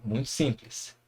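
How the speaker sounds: ordinary talk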